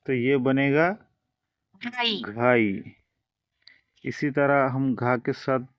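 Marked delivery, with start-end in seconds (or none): 1.8-3.0 "घाई" stretched very slowly, gliding aa into ee; drawn-out ghai. Shown as a demonstration. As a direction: sound out "घाई" syllable by syllable. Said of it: "अब हम जोड़ रहे हैं आई".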